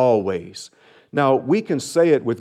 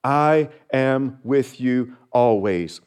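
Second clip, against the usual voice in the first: tender divine voice; slower than usual. Acted. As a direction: let each word land gently and slowly. Pastor voicing the Lord's words.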